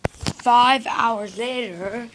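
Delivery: ordinary talk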